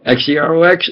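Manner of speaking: ordinary talk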